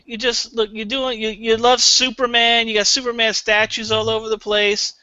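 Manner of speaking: ordinary talk, nothing marked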